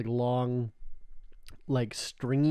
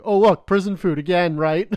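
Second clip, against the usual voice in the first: loud, higher-pitched mock quote; mocking comeback; 5 semitones higher, 9 dB louder than usual. Friend jabbing back over the Ethiopian food photo, mocking. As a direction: imitate a friend's pointed jab, raised voice. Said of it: "She's like".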